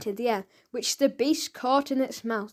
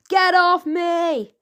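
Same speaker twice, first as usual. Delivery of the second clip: higher, louder, slow scream; 6 semitones higher, 6 dB louder, much slower than usual. A narrator's reading. Boy grabbed by a henchman, screaming louder and higher. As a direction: struggle and scream. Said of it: "screamed the boy".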